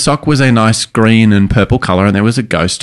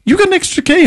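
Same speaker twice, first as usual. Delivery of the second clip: put-on much higher, wide-eyed kid voice; student's question. Teacher imitating her clarinet student's surprised question, much higher. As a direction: squeak it up like a curious young pupil. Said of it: "she goes".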